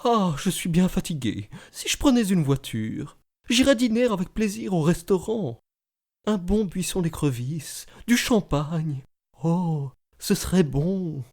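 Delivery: soft, musing lady's voice; lady talking to herself. Retold or imitated. Lady at the exhibition musing aloud to herself, soft soliloquy. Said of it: "Elle parlait toute seule".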